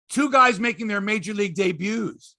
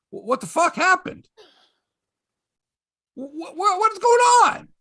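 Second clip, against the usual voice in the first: much higher, stammering outrage; exasperated; 10 semitones higher than usual. Real, not acted. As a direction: sputter in disbelief, rising. Exasperated outburst.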